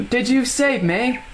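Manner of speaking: slow, high British voice; British accent. Pretend. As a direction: play it as a British boy. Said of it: "you make a good British accent".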